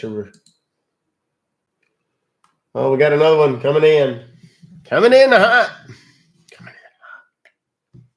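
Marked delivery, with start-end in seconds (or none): 2.7-4.2 excited. Real, not acted. Scammer call coming in. 4.8-5.7 high, loud hype call; hyped shout. Shown as a demonstration. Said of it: "That's how you know". 6.5-8.0 breathy whispered take. Shown as a demonstration. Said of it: "That's how you know".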